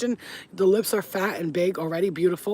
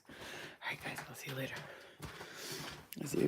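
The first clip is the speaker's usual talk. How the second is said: very soft, low sign-off; hushed goodbye; 5 semitones lower, 17 dB quieter than usual. Real, not acted. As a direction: half-whisper a farewell. Quiet goodbye, child asleep nearby.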